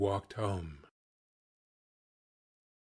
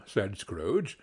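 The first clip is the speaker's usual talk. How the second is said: usual speaking voice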